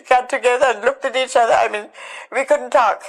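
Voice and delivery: words barely held together through tears; voice breaking. Real, not acted. Telling of finding her mother alive, voice breaking.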